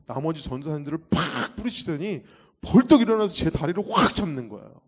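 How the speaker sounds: ordinary talk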